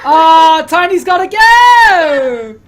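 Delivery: high, drawn-out loud wail; mock wailing farewell. Game master sees player Tiny leaving, slow mock-tearful wail. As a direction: wail it slowly like a tragic goodbye.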